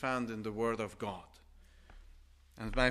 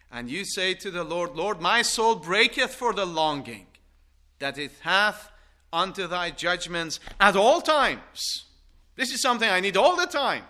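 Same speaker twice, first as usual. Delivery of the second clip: loud, raised, full-force preacher; impassioned preaching; 5 semitones higher, 11 dB louder than usual. Acted. Preacher voicing the psalmist's longing to God, loud and impassioned.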